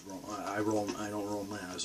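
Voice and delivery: usual speaking voice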